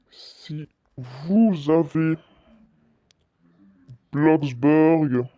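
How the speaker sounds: very slow, stretched-out words; slow dictation. Real, not acted. Streamer typing an announcement for Bloxburg players, slow dictation. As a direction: read it out as you type it.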